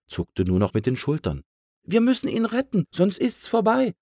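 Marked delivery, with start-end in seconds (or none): 1.8-3.9 very high little bird voice, pleading urgently; high-pitched, urgent sparrow; urgent sparrow cry. A narrator's reading. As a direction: cry out to save a friend. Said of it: "rief der Spatz".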